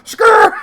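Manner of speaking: high, loud screeching tire noise; loud mock tire screech. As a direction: imitate the tires squealing. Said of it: "It's like going".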